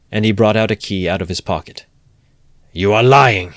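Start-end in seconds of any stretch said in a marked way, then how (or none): 2.7-3.5 loud furious roar. A narrator's reading. Raskolnikov accusing Porphyry of lying, loud furious roar. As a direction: let it burst out unrestrained. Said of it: "roared Raskolnikov without restraint".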